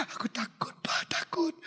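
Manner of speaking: high, breath-broken sobbing; tearful fear. Acted. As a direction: whimper like a scared child. Child crying, afraid to tell father.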